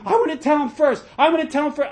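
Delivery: put-on high, loud, childlike clamour to be first; eager mock rivalry. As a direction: jostle to speak first, pitch way up. Acts out runners competing to tell.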